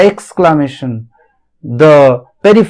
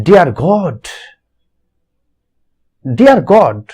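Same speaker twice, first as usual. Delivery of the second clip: restless exclamation; slower than usual. Retold or imitated. Teacher reading the poet's cry, a mildly emotional exclamation. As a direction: cry out to God, moved by the scene. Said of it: "become so nostalgic... restless".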